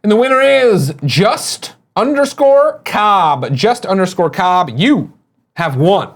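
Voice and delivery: slow, drawn-out winner announcement; announcer voice. Acted. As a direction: announce the winner like a game show host.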